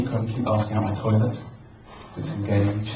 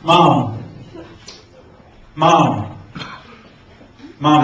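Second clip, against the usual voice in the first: loud, raised calls with long waits; insistent calling; 3 semitones higher, 5 dB louder, much slower than usual. Acted. Child to unresponsive mum, calling out loudly. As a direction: keep calling until mum answers.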